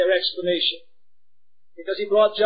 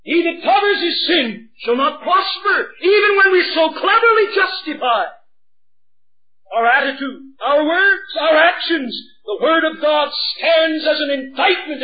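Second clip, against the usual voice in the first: higher and louder, crying out the warning; impassioned preaching; 8 semitones higher, 4 dB louder than usual. Acted. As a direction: preach it at full force. Preaching at full force.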